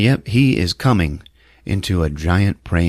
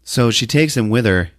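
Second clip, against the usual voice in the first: usual speaking voice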